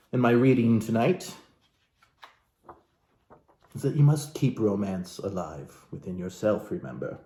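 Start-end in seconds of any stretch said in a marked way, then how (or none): none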